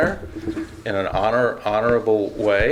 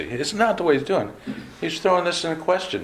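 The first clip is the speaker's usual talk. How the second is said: ordinary talk, nothing marked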